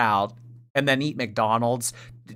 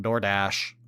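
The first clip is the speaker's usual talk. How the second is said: usual speaking voice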